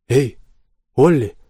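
loud call for someone hidden. A narrator's reading. Christopher calls for his vanished monster, loudly. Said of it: "окликнул его Кристофер".

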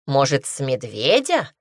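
plain reading voice

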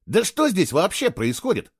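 high, insistent demand; boy demanding to know what's going on; demanding explanations. Acted. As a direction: press her hard for answers. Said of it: "потребовал объяснений".

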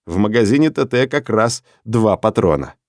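usual speaking voice

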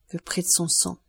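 hushed, understated murmur. A narrator's reading. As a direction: murmur it under your breath. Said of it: "murmura-t-elle".

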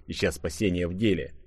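usual speaking voice

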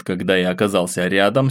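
plain reading voice

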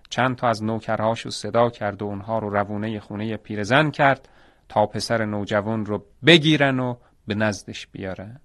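ordinary delivery

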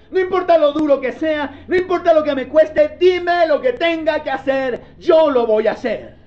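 preacher voicing total surrender; fervent preaching. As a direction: proclaim the vow like a pulpit climax. Preaching the surrendered believer's vow.

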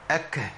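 أك ending in an audible puff of air; breathy k. Shown as a demonstration. Teacher showing the k's escaping breath. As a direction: let the air run out on the k of أك. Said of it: "لابد ليه من خروج نفس فقط".

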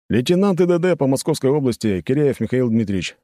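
rattled-off introduction. A narrator's reading. Patrol officer introducing himself at a roadside stop, rattled off. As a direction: reel off rank and name like a routine formula. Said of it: "скороговоркой выдал сотрудник".

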